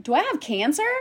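high, incredulous question; retold shocked question. Retold groggy shock at diagnosis.

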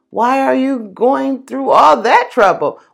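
high, loud teasing bug voice; carefree grasshopper. Acted. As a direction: scoff like a lazy, happy grasshopper. Grasshopper mocks the ant.

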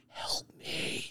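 unvoiced whisper, pleading for help; whispered plea. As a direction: breathe it like a captive begging. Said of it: "all of a sudden, I just lean over".

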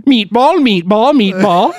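sing-song Swedish Chef impression. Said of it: "it's just gonna be Swedish Chef".

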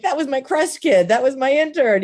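high, excited disbelief; amazed exclamation. Retold or imitated. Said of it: "I'm like".

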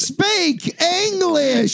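slow, drawn-out mock demand; mock outrage. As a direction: stretch it out like an indignant yokel. Mock complaint about [name].

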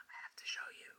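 unintended hushed whisper; very soft, voiceless whisper. Real, not acted. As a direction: whisper it like a secret. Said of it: "Why am I whispering".

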